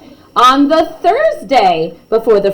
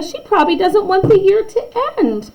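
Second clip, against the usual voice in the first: lightly voiced schoolkid, higher; kid voice; 11 semitones higher than usual. Classmates guessing about their teacher, lightly voiced kids. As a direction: play a kid imagining the teacher. Children quoted in story.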